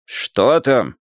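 ordinary delivery

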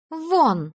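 loud, high-pitched order; commanding. A narrator's reading. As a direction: command someone out of the room. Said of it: "скомандовала Женя". (громкий, повелительный приказ)

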